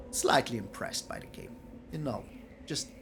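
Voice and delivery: whisper. Real, not acted. Whispered aside to viewers.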